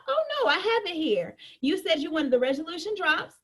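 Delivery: ordinary talk, nothing marked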